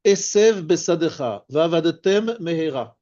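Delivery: Esev Besadecha, Vavadetem Mehera with matching letters kept apart; careful word separation. Shown as a demonstration. Teacher showing identical letters meeting between words, careful separation. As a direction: articulate the end of Esev before starting Besadecha. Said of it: "Chaque fois qu'il y a deux lettres".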